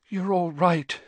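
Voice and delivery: high-voiced, distracted reassurance to herself; an overwrought mother, half muttering. A narrator's reading. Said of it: "Missus Weasley muttered distractedly".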